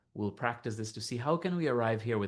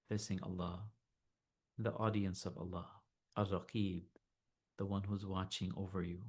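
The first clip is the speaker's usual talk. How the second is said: soft, unhurried meditation voice; guided meditation; 7 dB quieter than usual. Acted. Teacher leading listeners to notice body and heart, calm meditation voice. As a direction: gently guide them inward, leaving long silences.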